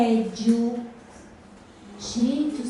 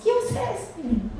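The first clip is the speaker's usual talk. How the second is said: surprised mother; 8 semitones higher, faster than usual. Retold or imitated. Mother reacts to child's remark.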